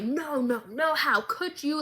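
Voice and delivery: ordinary talk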